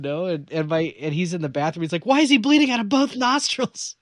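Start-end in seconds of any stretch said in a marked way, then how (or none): 2.1-4.0 high, loud imitation of a panicked parent; mock-panicked shouted question; alarmed dad. Dad examines son's bloody nose, shouting in alarm.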